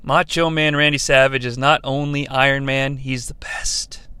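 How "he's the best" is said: unvoiced whispered aside. Real, not acted. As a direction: whisper it like a secret. Whispered aside after big claim.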